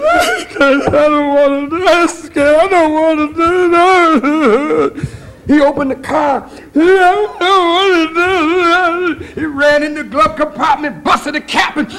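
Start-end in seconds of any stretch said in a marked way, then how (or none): 0.0-9.2 high, slow whimpering plea; mild mock sob; crying band boy. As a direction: whine it like a tearful young man. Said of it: "I seen him crying".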